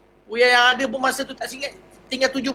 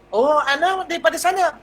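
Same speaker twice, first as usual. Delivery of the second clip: higher, bright greeting; friendly stranger; 7 semitones higher than usual. Retold or imitated. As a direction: greet him cheerfully. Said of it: "Dia kata". (sapaan mesra, nada tinggi)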